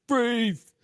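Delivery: high, sharp shout of freeze; a cop's sudden barked order; shouted command. Retold or imitated. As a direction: yell it at a fleeing man. Cop stops running suspect.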